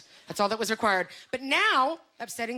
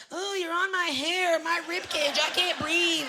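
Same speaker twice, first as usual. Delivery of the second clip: mock fragile skinny girl; 8 semitones higher, slower than usual. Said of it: "she's one of those girls that's all like".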